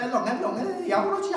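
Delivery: high, sing-song mock taunt; playful teasing. Preacher mimicking harmless childish teasing, playful taunting. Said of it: "하고 놀리는".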